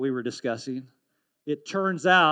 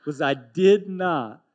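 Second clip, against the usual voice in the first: laughing; 2 semitones higher, 7 dB louder, slower than usual. Real, not acted. Laughing through sermon story.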